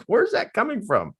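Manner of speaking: high-pitched bewildered question; startled confusion. Retold or imitated. Man in truck seeks the knocking, bewildered. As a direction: act out searching for a puzzling noise.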